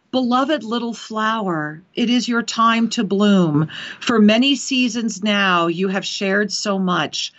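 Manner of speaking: low, slow, solemn voice of the angels; channelled spirit message. Acted. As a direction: deliver it slowly and deeply, like a voice from beyond. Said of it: "they're saying".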